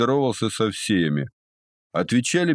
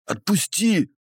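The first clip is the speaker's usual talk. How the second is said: high squeezed-nose whine; nasal pained plea; 12 semitones higher, slower than usual. Acted. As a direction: beg with nose pinched shut.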